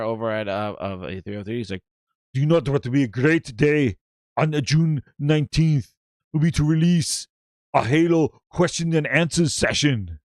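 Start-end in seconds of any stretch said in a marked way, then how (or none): 2.3-10.2 mock Arnold Schwarzenegger accent; halting, Arnold-style accent impression; Pierre as Arnold. Host mimicking the Halo studio's Pierre, in an Arnold accent. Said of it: "He talks like Arnold".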